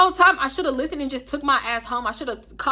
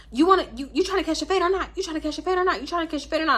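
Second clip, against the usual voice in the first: higher-pitched mock challenge; confrontational challenge; 7 semitones higher, faster than usual. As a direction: imitate someone picking a fight, pitched up. Acted quarrel, friend's repeated challenge.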